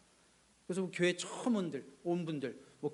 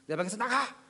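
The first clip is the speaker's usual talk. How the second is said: mock angry shout; 5 semitones higher than usual. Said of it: "성질을 부리면서".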